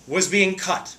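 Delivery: ordinary talk, nothing marked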